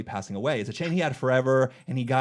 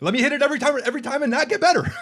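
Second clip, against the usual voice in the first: high, loud laughing wish; mock wishful squeal; 12 semitones higher, 4 dB louder, faster than usual. Friend jokes about always nailing it, high laughing squeal.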